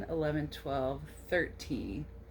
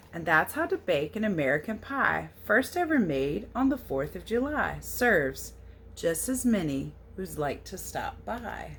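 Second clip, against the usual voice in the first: storybook read-aloud; 6 dB louder than usual. A narrator's reading. Reading the American Pie book aloud to children, storybook lilt. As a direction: read the verse a little louder, with a gentle lilt. Reads the picture book text.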